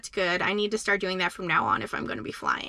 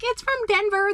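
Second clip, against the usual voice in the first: retold kid voice; 11 semitones higher than usual. Kids mock her souvenir.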